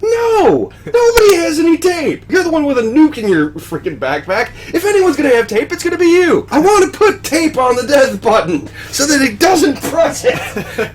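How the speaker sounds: high, loud mock rant; exasperated yelling. Rocket ranting at Star-Lord about tape, loud mock yelling. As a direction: rant at him, fed up and loud. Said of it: "He's like".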